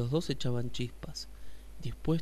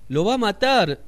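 alarmado; 8 semitones higher, 11 dB louder than usual. Acted. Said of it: "dijo el oso hormiguero".

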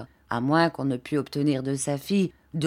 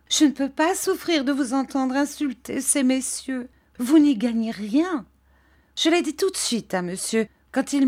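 put-on higher, imploring old mother's voice; pleading mother; 6 semitones higher than usual. A narrator's reading. Mrs Heep pleading with the gentlemen, higher and imploring. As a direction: beg the gentlemen to spare him.

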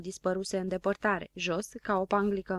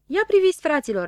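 high, loud shout; calling out; 8 semitones higher, 8 dB louder than usual. A narrator's reading. Habar n-am calls his friends to look, shouting. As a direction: call everyone to look. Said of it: "începu să strige Habar n-am".

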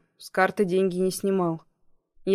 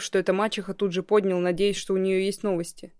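plain reading voice

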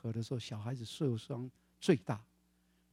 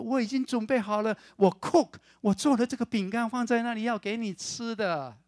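put-on high, loud eager little girl; 7 semitones higher, 6 dB louder than usual. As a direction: sound eager to please mom. 女儿电话里讨好妈妈. (女儿在电话里急切地讨好妈妈)

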